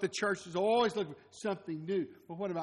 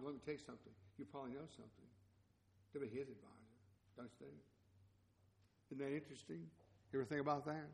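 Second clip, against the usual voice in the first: much softer, confiding, lower; quiet aside; 3 semitones lower, 14 dB quieter, much faster than usual. Real, not acted. Preacher confiding softly to the congregation about Solomon's advisors. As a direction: lean in and let the congregation in on it.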